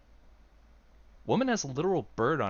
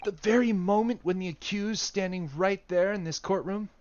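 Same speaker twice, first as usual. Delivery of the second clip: raised posh British courtroom voice; British accent in a higher voice; 6 semitones higher than usual. Acted. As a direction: play it in a British accent, pitched up. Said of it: "Oh, oh, it's still Hasanoga".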